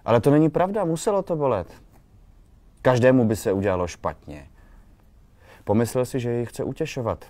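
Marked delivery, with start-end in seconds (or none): 0.0-4.5 higher, louder irritated protest; annoyed insistence, rising high then settling. A narrator's reading. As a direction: contradict him with irritation, voice jumping up. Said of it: "Podrážděně řekla".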